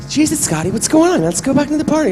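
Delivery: high, loud exasperated movie impression; Mark Wahlberg. Actor playing a man urging his friend back inside, high exasperated impression. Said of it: "Okay, Mark's like".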